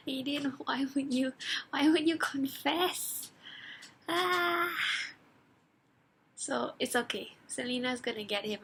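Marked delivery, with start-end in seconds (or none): 0.1-3.3 dismayed plea. Retold or imitated. Fan pleading with Aiden after his capture, dismayed. 4.1-4.7 high drawn-out "ah" of dismay; frustrated wail. Retold or imitated. Reader lamenting a character's capture, frustrated wail. Frustrated groan after the plea.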